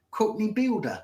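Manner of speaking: hammed-up Cockney builder accent. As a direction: put on a Cockney tradesman. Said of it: "there's an excellent".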